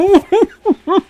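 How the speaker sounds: high, loud burst of imitated giggling; laughter bursting out despite trying to hold it in; stifled laughter. Vlogger imitating Dreyfus losing it at the eulogy, stifled laughter. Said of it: "He's trying not to laugh".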